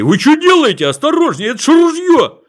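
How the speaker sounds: high panicked shout; terrified yell. Acted. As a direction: shriek in sheer fright. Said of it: "В ужасе заорал полицейский". (испуганный крик)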